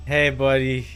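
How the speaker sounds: normal talking voice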